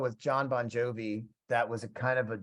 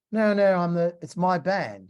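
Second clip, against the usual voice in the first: louder, higher mock British protest; British accent; 6 semitones higher, 5 dB louder than usual. British musician correcting the guest about his band, mock British accent. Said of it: "he's got a British accent".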